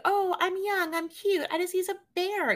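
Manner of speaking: mocking a cutesy girl's voice. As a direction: play a demure cute girl, pitched high. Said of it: "it's like".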